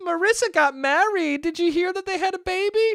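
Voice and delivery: very high mock-mom chatter; put-on high, chatty mom voice; gossiping mom. Mom gossiping with old woman, voiced.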